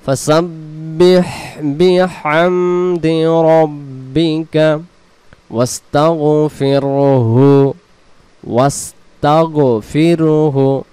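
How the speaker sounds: slow ফাসাব্বিহ with the final হ voiced; slow model reading; correct recitation. Shown as a demonstration. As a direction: recite ফাসাব্বিহ বিহামদি রব্বিকা slowly. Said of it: "উচ্চারণে কি বলবো".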